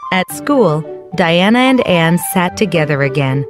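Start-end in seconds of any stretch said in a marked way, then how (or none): none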